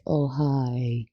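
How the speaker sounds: normal talking voice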